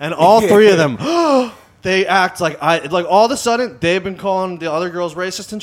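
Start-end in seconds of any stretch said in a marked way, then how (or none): 1.0-1.5 high mock gasp of offense; imitated horrified intake of breath; shocked gasp. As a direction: gasp as if scandalized. Imitates three offended people gasping.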